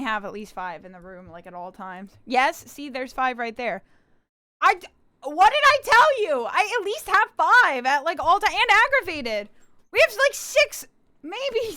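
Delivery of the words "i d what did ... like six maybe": loud, high, worked-up gloating; shouting vindication, high and excited; triumphant told-you-so. Real, not acted. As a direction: crow it at full volume.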